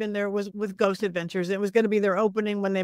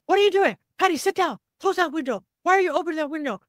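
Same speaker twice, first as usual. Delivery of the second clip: higher, louder retold yelling; imitating a yell; 6 semitones higher, 3 dB louder, faster than usual. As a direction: bark it like an alarmed friend. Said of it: "Zach starts yelling at me".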